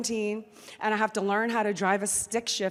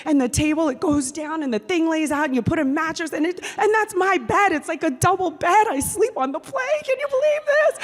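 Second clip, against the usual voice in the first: loud, fast, climbing to a high squeal; so excited; 6 semitones higher, 7 dB louder, faster than usual. Retold or imitated. Daughter showing her mom the bed on the plane, so excited. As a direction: build from eager to giddy disbelief. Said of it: "I'm, like, so excited".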